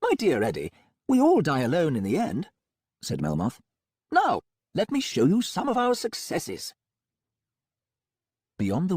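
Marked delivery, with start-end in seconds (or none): 0.0-2.5 higher, smooth, faster doctor; breezy, untroubled villain doctor; Melmoth voice. A narrator's reading. Melmoth philosophising. 4.1-6.7 higher, smooth, faster doctor; breezy, untroubled villain doctor; Melmoth voice. A narrator's reading. Melmoth invites tour.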